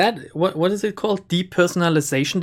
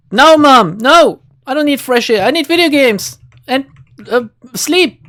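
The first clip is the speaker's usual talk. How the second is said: higher, louder retort to mom; playful, raised-pitch refusal; mock protesting child; 5 semitones higher, 4 dB louder than usual. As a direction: push back like a whining kid, half in jest. Acted reply to his voiced mother.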